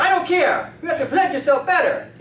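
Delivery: high, loud, dismissive telling-off; acted scolding. Drunk man overrules the chiropractor's excuse, loud scolding. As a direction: cut off the excuse at full voice. Street drinker scolding him.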